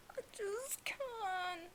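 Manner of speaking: high, soft, slow near-whimper; overcome. Real, not acted. Fan unable to cope with the chapter, tiny tearful voice. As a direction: sigh and trail out a tiny tearful voice.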